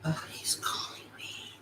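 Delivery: unvoiced whisper. Real, not acted. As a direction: whisper so the caller won't hear. Whispers while phone rings.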